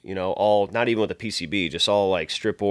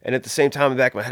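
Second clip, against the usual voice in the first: ordinary talk, nothing marked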